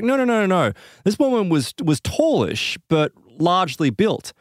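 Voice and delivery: high, emphatic protest, lightly voiced; retold insistent woman. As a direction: insist on it, pitch jumping up high.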